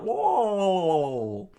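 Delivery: high loud yelp; scream. Acted. Octopus man screams as blood gushes, high loud cry. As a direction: scream out in pain. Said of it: "Then screamed out".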